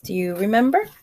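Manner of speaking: ordinary delivery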